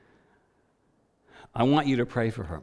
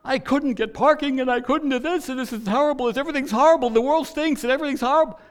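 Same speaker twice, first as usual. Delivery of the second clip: much higher, louder frantic rant; upset tirade; 6 dB louder than usual. Retold or imitated. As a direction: spill every complaint in one breathless, high-pitched rush. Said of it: "she went into a tirade".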